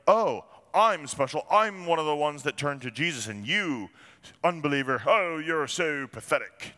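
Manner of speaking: hammed-up self-righteous sneer; smug mock believer. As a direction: look down your nose at the unbeliever. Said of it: "we dare not become arrogant".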